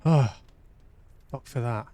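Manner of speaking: usual speaking voice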